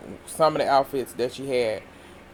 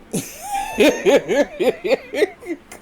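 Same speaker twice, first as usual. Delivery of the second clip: high laughing fit. Real, not acted. Host cracking up at Sutton's comeback, high laughing fit. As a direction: break into high helpless laughter.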